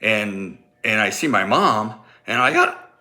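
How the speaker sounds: normal talking voice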